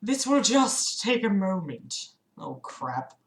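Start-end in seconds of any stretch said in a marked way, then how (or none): 0.0-2.1 softer, calm aside; sighing calm. Acted. Reading the hero's calm aside to Aubrey, softly. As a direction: say it wearily, like a sigh. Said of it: "I then sighed and said to Aubrey".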